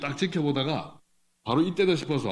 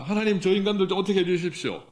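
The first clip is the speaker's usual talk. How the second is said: higher, louder plea against enemies; pleading prayer; 6 semitones higher, 3 dB louder than usual. Acted. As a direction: beg God to deal with them. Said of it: "이런 기도까지 지금 하고 있습니다".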